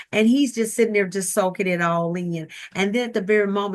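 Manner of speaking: ordinary delivery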